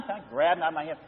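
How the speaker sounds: ordinary delivery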